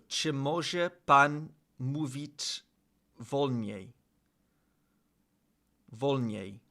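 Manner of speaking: slow, syllable-by-syllable Polish practice; slow careful Polish. Shown as a demonstration. Learner sounding out Polish, slow and careful. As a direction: sound out each Polish word slowly.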